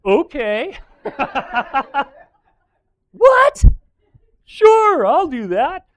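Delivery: very high, loud hammed-up naive yes; dopey agreement. Jehoshaphat agreeing to wear king clothes, high hammed-up naive voice. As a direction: play him as a gullible pushover, squeaky.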